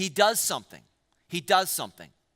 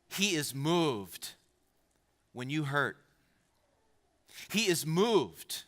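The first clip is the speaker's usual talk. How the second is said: slow and soft, he is moved; sincere slow comfort; tender, slow reassurance; 3 semitones lower, 4 dB quieter, slower than usual. Real, not acted. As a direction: reassure the hurting congregation gently. Tender point about God caring.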